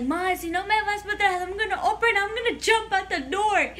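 high, rushed imitation of a frantic teen; hammed-up kid's ultimatum to mom; retold dramatic threat. Child threatens mother in retold quarrel.

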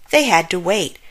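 usual speaking voice

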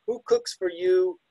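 slow, sing-song "Who cooks for you"; drawn out like the owl's call; slow owl mnemonic. Shown as a demonstration. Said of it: "the classic mnemonic".

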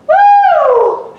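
high, loud cheering whoop; excited whoop. Real, not acted. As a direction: let out a big happy whoop. Coach cheering mid-workout.

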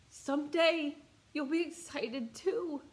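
slow, high, tender promise; a stranger encouraging a crying woman, tenderly; gentle reassurance. Retold or imitated. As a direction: say it like a kind hand on the shoulder. Stranger's hopeful parting words.

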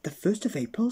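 high questioning surprise; surprised. Acted. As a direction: sound astonished. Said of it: "very much surprised".

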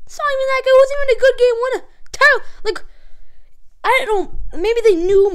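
high-pitched, indignant rant. Real, not acted. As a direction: fire it off in disbelief. Indignant about the game winner.

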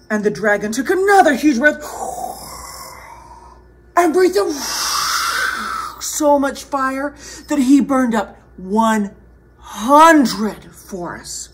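animated telling with big breaths; storyteller for children, stretching the key number; dramatic story narration. A narrator's reading. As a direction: build up the dragon's huge feat.

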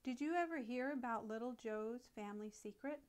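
ordinary delivery, nothing marked

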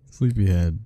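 ordinary talk, nothing marked

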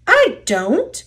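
high-pitched protest; protesting denial. A narrator's reading. Duck denies telling tales to the engines, protesting. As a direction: deny it in a squeaky-high storybook voice. Back-and-forth quarrel in story.

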